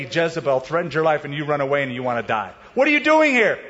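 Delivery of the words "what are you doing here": high, loud challenge; God challenging Elijah, loud and high; demanding question. Retold or imitated. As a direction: press the question hard. Preacher repeats God's question.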